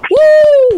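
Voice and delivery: high excited woo; cheering woo. Real, not acted. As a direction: whoop it out loud. Cheering the team on.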